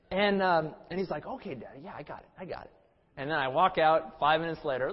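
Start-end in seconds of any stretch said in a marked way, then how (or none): 1.2-2.7 put-on very soft, offhand child's reply; quiet, dutiful mock agreement; placating little son. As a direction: imitate a kid quickly agreeing with Dad. Child placates father, retold.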